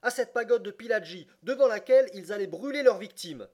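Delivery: loud, high-pitched impetuous outburst; heated, impulsive exclamation; impetuous cry. A narrator's reading. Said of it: "s'écria impétueusement Passepartout".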